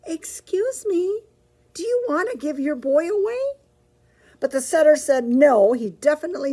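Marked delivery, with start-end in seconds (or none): 0.1-3.4 calling out politely. Acted. Petey calling out to the collie, high and polite. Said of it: "called out politely".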